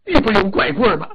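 fast, loud sarcastic jab; sharp retort. Acted. The Dragon King mocks the Earth God's excuse, sharply. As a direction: snap back at his excuse.

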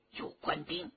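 hushed whispered warning; 轻声耳语. Acted. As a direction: warn a companion under your breath. Whispered warning to companion in hiding. (悄声示警)

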